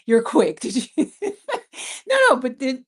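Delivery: laughing while talking; amused laugh through words. Real, not acted. Laughing at viewer's quick answer.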